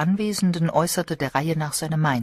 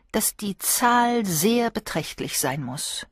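put-on slow, higher, gentle old lady; thoughtful; 6 semitones higher, slower than usual. A narrator's reading. Old Miss Marple thoughtfully contradicting the guests, slow. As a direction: weigh each word calmly. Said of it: "bemerkte Miss Marple nachdenklich".

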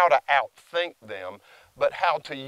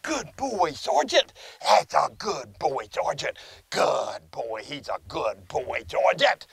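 loving reward voice; slower than usual. Shown as a demonstration. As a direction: praise the dog sweetly, pitched up. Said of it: "that's my love reward".

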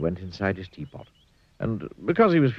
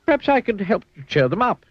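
Mister Funny voice; 10 semitones higher than usual. Acted. Mister Funny speaks to zookeeper.

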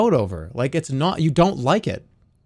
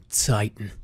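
lightly voiced Aaron; 7 semitones lower, 4 dB quieter than usual. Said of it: "Now do the Aaron impression".

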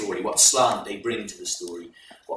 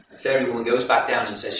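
ordinary delivery, nothing marked

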